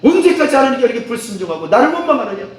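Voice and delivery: high-pitched acted angry rebuke; 화난 꾸짖음. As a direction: demand it like an exasperated leader. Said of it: "화가 나는 거예요". (화난 듯 높여 꾸짖기)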